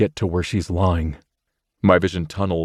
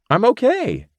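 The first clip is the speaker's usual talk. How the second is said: put-on much higher, louder girl's voice; Rachel calling back; 3 dB louder than usual. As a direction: call across the park to her friend. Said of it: "Rachel yells to her".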